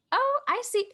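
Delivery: high-pitched mock "oh"; modelled teen reaction. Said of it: "just be like".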